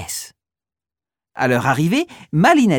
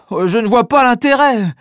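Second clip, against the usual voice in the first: grumbling; 6 semitones higher, 4 dB louder than usual. Acted. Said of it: "bougonne Oscar".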